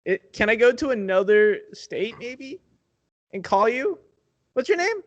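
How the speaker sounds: panicked caller pitching up; desperate customer. Pretend. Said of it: "let's play it out".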